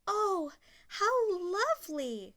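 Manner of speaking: delighted cry. Acted. Cinderella to the birds and mice, high and thrilled. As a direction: gasp with delight. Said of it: "she cried".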